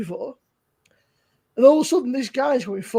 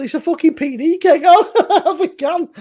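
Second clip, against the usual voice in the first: raised, laughing retort; retold retort; 5 semitones higher, 6 dB louder than usual. Scene: retelling arguing with a beer reviewer, raised voice laughing. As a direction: fire back, half laughing. Heated retold argument with him.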